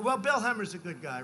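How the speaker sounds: usual speaking voice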